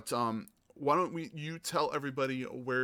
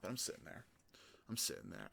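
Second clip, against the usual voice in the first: hushed aside; 3 semitones lower, 12 dB quieter than usual. Real, not acted. Storyteller drops voice, whispery.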